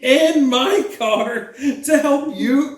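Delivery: high, drawn-out exasperated protest; mock-indignant rant. Real, not acted. Reminding partner of the car-buying favour, mock-indignant.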